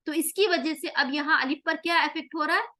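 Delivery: usual speaking voice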